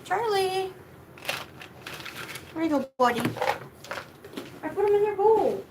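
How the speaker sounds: pet talk. Real, not acted. Coaxing dog to eat.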